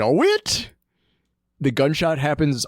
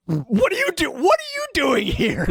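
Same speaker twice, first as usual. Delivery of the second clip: much higher, startled quote; mock startled Saeed; 14 semitones higher than usual. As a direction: yelp it like a man caught off guard. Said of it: "and he's like".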